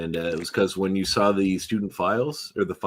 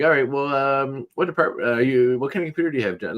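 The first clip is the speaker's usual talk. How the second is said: lightly imitated agent, pitched higher; Gateway phone agent; 6 semitones higher than usual. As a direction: play a helpful phone clerk. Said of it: "the woman's like".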